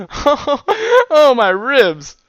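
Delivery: high, loud gasp after laughing; hammed-up laughed-out wail; helpless with laughter. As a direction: cry out as if your sides ache from laughing. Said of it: "wiped a tear of laughter".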